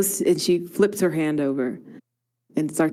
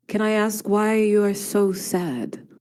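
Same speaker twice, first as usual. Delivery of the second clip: solemn probing; slower than usual. Acted. Seer asking a stranger about her hidden sorrow, solemn and slow. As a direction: let it out slowly, fading to near-whisper.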